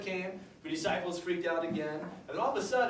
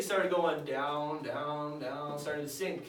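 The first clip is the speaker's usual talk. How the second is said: sinking slowly; 4 semitones lower, much slower than usual. Acted. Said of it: "sinking voice".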